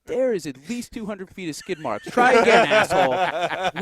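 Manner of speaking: dad calls out the lie about speed; dad yelling. Retold or imitated. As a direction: bark it like a fed-up father. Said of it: "He's like".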